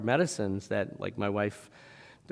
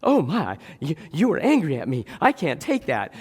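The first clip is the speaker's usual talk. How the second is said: higher, louder, hammed-up offence; mock hurt feelings; 5 semitones higher, 6 dB louder than usual. God reacting to an angry prayer, mock hurt. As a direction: play wounded and overdramatic. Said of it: "God's feelings might be hurt".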